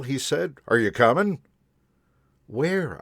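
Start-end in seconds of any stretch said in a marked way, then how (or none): 0.7-1.4 conductor. A narrator's reading. Conductor voiced in story. 2.5-3.0 surprised question. A narrator's reading. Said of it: "I asked".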